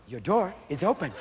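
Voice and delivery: softly spoken car alert; lightly voiced automated door warning; talking car. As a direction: say it softly, as a car's recorded warning. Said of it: "new Japanese cars where you open the door and it goes".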